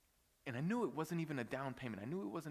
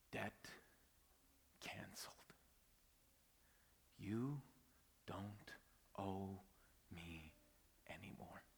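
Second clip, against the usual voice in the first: hushed whisper; 4 semitones lower, 8 dB quieter, slower than usual. Retold or imitated. Preacher reading his private pages in a whisper. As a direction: whisper the words he wrote, barely voiced.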